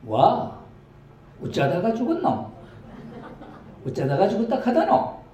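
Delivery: put-on a startled old country woman with laughter in the voice; Gyeongsang grandma. Second grandma asks how he died, in high Gyeongsang dialect. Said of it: "다른 할매가".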